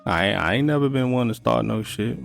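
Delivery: ordinary talk, nothing marked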